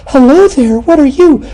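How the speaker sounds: high falsetto impression of a young woman; young lady's voice. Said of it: "twenty-year-old lady opens the door".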